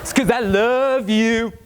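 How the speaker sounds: high, slow, slurred love; drunk affection. Retold or imitated. Drunk son to Dad, slow slurred and high. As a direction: drag it out sloppily. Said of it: "How it actually went".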